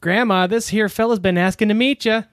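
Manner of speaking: high, cheerful little bug voice; Ted voice. Acted. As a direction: chirp it like an eager little critter. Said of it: "[name], you're Ted".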